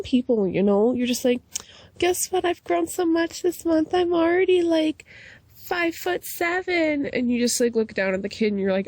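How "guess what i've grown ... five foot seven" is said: put-on much higher proud child voice; bragging kid. Kid boasting to adult about height, high childish voice.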